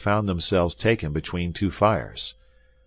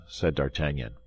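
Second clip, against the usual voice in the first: ordinary delivery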